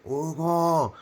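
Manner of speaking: put-on high, loud call of Hugo in a heavy paisa accent; paisa mom accent. The friend's upset mom phoning at dawn, in a paisa accent. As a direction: imitate a paisa mother's accent calling out. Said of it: "she's a little paisa, so she talks with the accent".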